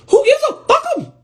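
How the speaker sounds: high, squealed outburst of disbelief; exasperated dismissal. Acted. Answering a worried friend, exasperated and high. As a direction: shoot up high, waving it off.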